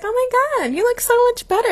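high gushing compliment; gushing friends. Retold or imitated. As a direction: gush like amazed friends. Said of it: "everyone's like".

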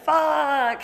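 loud, high angry swear; frustrated curse. Retold or imitated. Friend hearing the couple is back together, loud swear. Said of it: "You know, he's like".

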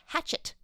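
Hachette said like the English word hatchet; anglicized pronunciation. Shown as a demonstration. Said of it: "it should be pronounced hatchet".

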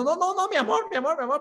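high-pitched, flustered mock wife voice; a hammed-up high falsetto of a panicking wife; nervous wife. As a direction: squeak out a nervous cover-up for the cheating wife.

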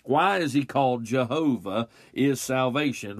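usual speaking voice

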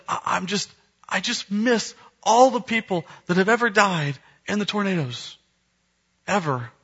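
high, imitated child's lament; mock crying child. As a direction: plead like a sad child, mildly hammed up. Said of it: "she starts crying uncontrollably".

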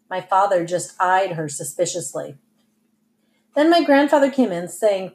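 usual speaking voice

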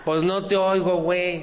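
high, loud laughing shout. Acted. Man hard of hearing yelling at a mumbling speaker, high and loud with laughter. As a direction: yell through laughter that you can't hear her. Acted deaf exchange, shouting.

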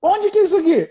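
very high, alarmed question; startled wife. Retold or imitated. Wife asks her husband where they are, very high startled voice. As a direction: she suddenly realizes where she is.

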